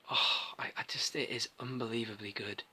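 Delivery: soft, near-whispered admiration; hushed awe. Real, not acted. Reviewer raving about this Vader figure, hushed awe. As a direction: drop almost to a whisper in awe. Awed praise of favourite figure.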